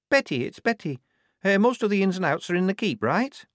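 high-pitched, hesitant recruit; timid young recruit. Acted. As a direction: ask tentatively in a high voice.

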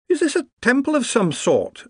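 put-on high, excited old wizard; curious wizard peering around. A narrator's reading. Wizard voice in narration.

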